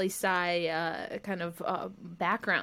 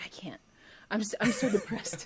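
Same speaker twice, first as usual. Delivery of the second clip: mock despair. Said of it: "I was like".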